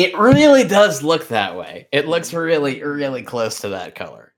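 grinning while speaking; smiling voice. Real, not acted. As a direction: let the smile come through. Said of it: "Can you hear the smile in my voice there?".

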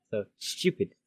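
ordinary talk, nothing marked

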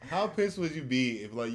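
ordinary talk, nothing marked

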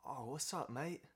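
lightly put-on Aussie koala; Australian accent. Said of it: "not even gonna bother doing the accent".

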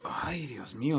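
breathy whispered dismay; whispered dread. Retold or imitated. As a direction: whisper it like you're bracing for trouble. Dread at boss's message.

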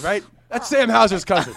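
high loud outraged fan; indignant fan. Pretend. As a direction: yell like a confused fan. Continues fan voice.

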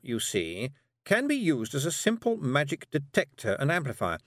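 a busy, slightly nervous witch; Miss Tick. A narrator's reading. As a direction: witch talking a girl into a walk. Miss Tick's voice.

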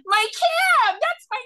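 shrill yelled Midwest cry, pitch way up; Midwest accent. Retold or imitated. Woman left behind shrieks for her cab, Midwest accent. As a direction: wail it like a stranded Midwest woman. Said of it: "Midwest accent that comes out".

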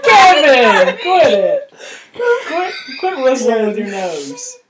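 high, loud laughing reprimand; laughing scold. Real, not acted. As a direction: scold him while cracking up.

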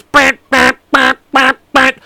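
loud high blaring alarm noise; imitated fire alarm. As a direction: blare it out like an alarm going off.

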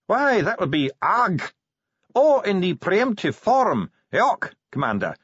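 high, deliberate dwarf voice; Bashfulson, helpful dwarf scholar. A narrator's reading. Dwarf translates for the commander, high and precise. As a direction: explain it high, stretching the foreign words.

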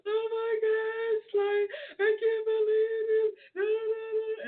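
high, slow tearful quote; imitating the crying winner, voice breaking. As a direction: sob it out as the overwhelmed winner. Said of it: "She's like".

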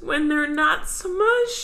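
put-on high, slow kid voice; cartoon kid voice. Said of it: "And he says".

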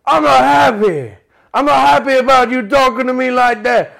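high, loud, slow hammed-up De Niro shout; mock furious De Niro on the phone; De Niro impression. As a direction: yell it like an angry, overacted De Niro. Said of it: "can you do De Niro?".

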